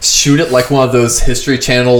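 ordinary talk, nothing marked

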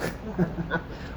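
quiet little laugh to oneself; soft chuckle. Real, not acted. Chuckles after wishing good luck.

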